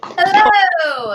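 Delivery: high bright hello; cheery greeting. Real, not acted. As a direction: call out a welcome. Host greets guest.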